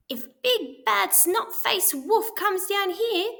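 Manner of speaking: high sly pig voices; chuckling pigs. A narrator's reading. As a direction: gloat with a chuckle. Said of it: "they chuckled".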